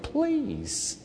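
mock-exasperated aside; scoffing please. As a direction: wave off the excuse. Scoffing at wardrobe excess.

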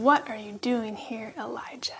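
God's still small voice, whispered; gentle whisper. Acted. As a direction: breathe the question softly like a gentle wind. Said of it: "a gentle blowing".

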